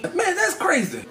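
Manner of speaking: high, drawn-out mock amazement; amazed friend. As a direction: imitate a friend blown away, slow and high.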